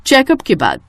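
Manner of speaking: ordinary delivery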